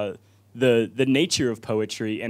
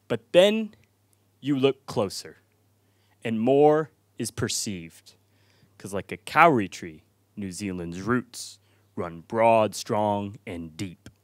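slow, hushed verse sinking lower; spoken-word poem; 3 semitones lower, slower than usual. Real, not acted. Poem turns reflective.

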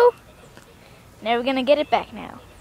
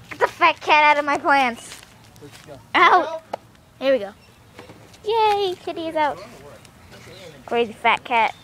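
ordinary talk, nothing marked

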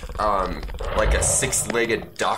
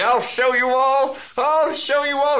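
very high, louder squeaky Keith voice; NPC voice; 5 dB louder than usual. Acted. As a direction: shriek it out high like a panicked little villain. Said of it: "He's like".

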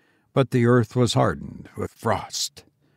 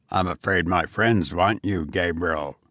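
goblin voice; 7 semitones lower than usual. A narrator's reading.

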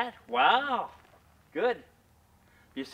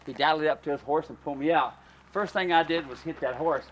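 ordinary talk

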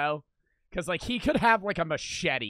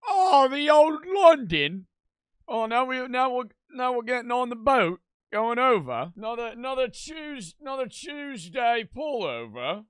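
hammed-up British accent, higher and louder; mock British accent; 8 semitones higher, 6 dB louder than usual. Teasing the London police clip in a mock British accent. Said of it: "I know I make fun of it".

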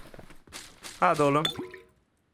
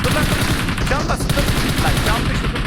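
panicked shout; 3 semitones higher, 7 dB louder than usual. Real, not acted. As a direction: yell to stop the thief in a panic. Enemy stealing his loot.

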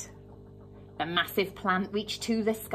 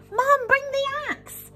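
much higher, loud slow call to Mum; 7 dB louder, slower than usual. Acted. Jack calling to his mum from the beanstalk, loud. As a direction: call down urgently for the axe. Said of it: "He called".

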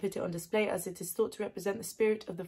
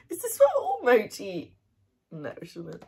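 overwhelmed; 6 dB louder than usual. Real, not acted. Overwhelmed by the soft mochi.

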